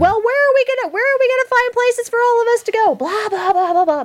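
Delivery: high-pitched mock screech; retold loud complaining. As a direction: loudly whine in a high voice, imitating her. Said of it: "like, screaming over the preview".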